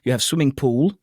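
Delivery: careful, standard RP English; RP British accent. Shown as a demonstration. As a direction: demonstrate Received Pronunciation, each vowel distinct. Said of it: "I'm gonna do it R P".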